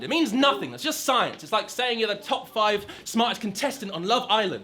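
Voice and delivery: ordinary talk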